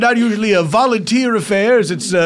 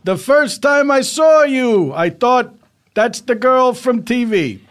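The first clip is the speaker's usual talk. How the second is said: groom reading vows; 6 semitones higher, 3 dB louder than usual. Acted. Groom reads wedding vows.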